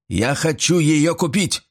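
high, slow, insistent declaration; imperious. A narrator's reading. Old man insists on buying the brooch, imperious. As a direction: press the demand with authority. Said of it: "неожиданно властным тоном".

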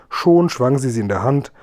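usual speaking voice

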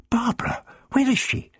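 anxious urgent outburst; urgent worry. Acted. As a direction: demand it urgently. Ian anxious for his friend.

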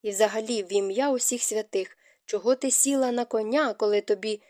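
plain reading voice

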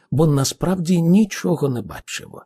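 usual speaking voice

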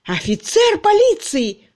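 very high, loud, scornful exclamation; furious, drawn-out outburst; raging sarcasm. A narrator's reading. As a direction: storm with bitter mockery. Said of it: "бушевал Кирк".

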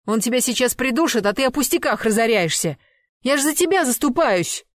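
high, loud woman's protest; exasperated scolding. Acted. Girlfriend standing up for her choking boyfriend, exasperated scolding. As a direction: argue back, voice climbing at the end.

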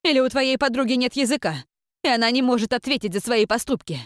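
high, loud, scornful rebuke; scornful aunt. Acted. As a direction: scold her with contempt. Aunt scolds niece's friend.

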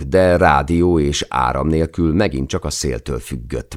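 plain reading voice

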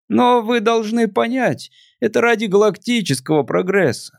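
put-on a melancholy drunk visitor; tipsy alien voice.

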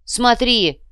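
high, loud order; sharp command. A narrator's reading. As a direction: call out a command. Commander orders him to look.

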